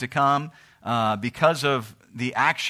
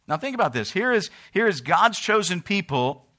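normal talking voice

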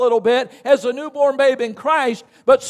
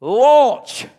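a single loud preached word; loud launch; 5 dB louder than usual. Real, not acted. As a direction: call it out to the church. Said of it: "Let's say it together".

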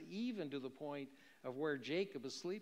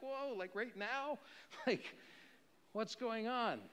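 startled Jacob; 8 semitones higher than usual. Acted. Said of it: "he's like".

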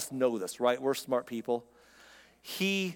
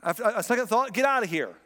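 dismissive; 7 semitones higher, 4 dB louder than usual. Retold or imitated. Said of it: "He says".

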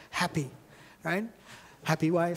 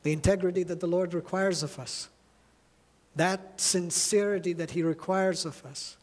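ordinary delivery

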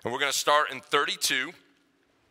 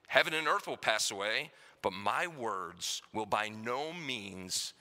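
softer, measured Bible reading; scripture reading; 5 dB quieter than usual. Acted. Pastor reads Matthew twenty-four aloud to the church, softly and solemnly.

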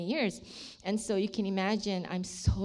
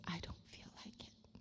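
breathy whisper, barely there; 3 semitones lower, 20 dB quieter than usual. Retold or imitated. Whispering her refusal to God in the dark. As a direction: whisper it, unwilling.

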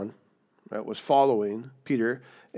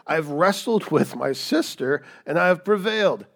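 boastful Rachel; 5 semitones higher, 6 dB louder than usual. Acted. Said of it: "as if this is a competition and I'm winning".

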